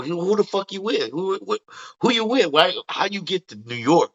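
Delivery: imitating a surprised brash comedian; Tracy Morgan voice. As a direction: pitch up and fire off the questions. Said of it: "he's like".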